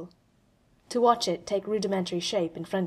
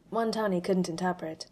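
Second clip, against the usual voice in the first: ordinary delivery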